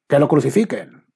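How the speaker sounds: loud, high call of the crowd; crowd shout. A narrator's reading. The crowd to Pilate, demanding his death, shouting. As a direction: shout it as an angry mob.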